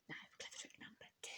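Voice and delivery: fast, breathless sneaky whisper. Acted. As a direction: hiss the plan under your breath. Sly crocodile plotting to himself.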